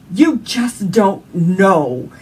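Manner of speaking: very slow, loud furious cry; yelling at the top of lungs. Retold or imitated. Mother screaming her grief at God, slow and loud. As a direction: drag out every word, full volume. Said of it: "I was yelling to the top of my lungs".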